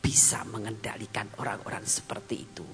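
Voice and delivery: low, soft, half-whispered sermon; gentle hushed preaching. Acted. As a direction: draw them in, quiet and low.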